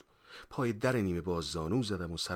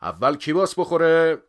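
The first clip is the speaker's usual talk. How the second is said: loud, high question to everyone; raised, ringing challenge; teacher quizzing class; 10 semitones higher, 14 dB louder than usual. A narrator's reading. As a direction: put it to the whole room. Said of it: "پر کرد و پرسید".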